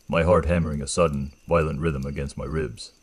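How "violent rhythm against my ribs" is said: ordinary delivery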